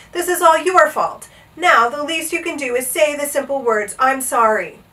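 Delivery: high, firm telling-off; scolding. A narrator's reading. Bartholomew tells the king it's his fault, scolding.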